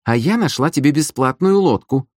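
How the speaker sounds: high, self-justifying protest; indignant. A narrator's reading. Sister boasting of the free boat, indignant. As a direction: argue back high and affronted. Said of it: "возмутилась сестра".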